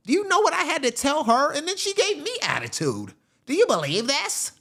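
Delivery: high-pitched, indignant mock agent rant; hammed-up off-duty agent telling a bar story; TSA agent crashing out. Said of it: "T S A agents in the bar crashing out".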